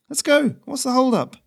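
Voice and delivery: very high, loud mock-grand address; teasing the goose with a grand title; Ferrino's voice. Messenger mock-grandly addresses his goose, very high.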